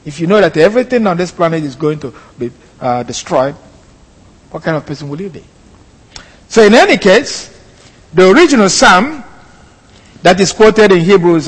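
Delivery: ordinary talk, nothing marked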